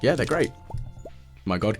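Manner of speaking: ordinary talk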